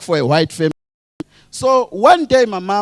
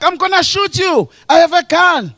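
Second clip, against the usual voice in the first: higher-pitched mock threat; raised, theatrical gunman's warning; acted warning; 9 semitones higher than usual. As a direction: call it out to a fleeing man.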